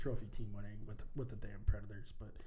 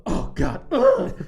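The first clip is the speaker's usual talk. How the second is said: mock pained groan; 14 semitones higher, 13 dB louder, much slower than usual. Mocking a hockey flop.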